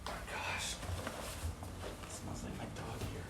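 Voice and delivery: whispering. Real, not acted. Sneaking in, whispering.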